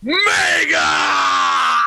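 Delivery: booming arena introduction; announcer call. Acted. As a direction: stretch the name like a ring announcer. Host announces cohost's name.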